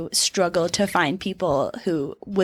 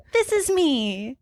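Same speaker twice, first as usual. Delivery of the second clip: mock naive entrance; 6 semitones higher, slower than usual. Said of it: "You were like".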